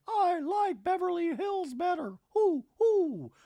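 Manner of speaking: high, hooty owl voice; loud, high-pitched comic owl; talking owl. Retold or imitated. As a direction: hoot the words like a cartoon owl. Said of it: "The owl's like".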